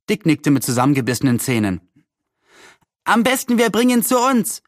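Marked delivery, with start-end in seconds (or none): 3.0-4.6 put-on much higher boy's call; a neighbour boy calling out a plan. A narrator's reading. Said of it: "rief einer der Nachbarjungen".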